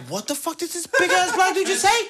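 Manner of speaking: high, put-on shocked viewer voice; mock shocked viewer. Host imitating stunned viewers of his clip, high and incredulous. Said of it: "people are like".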